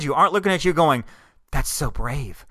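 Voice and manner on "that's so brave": hushed, lower mock awe; softly feigned admiration. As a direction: pretend to be deeply moved. Mimics imagined admiring onlookers.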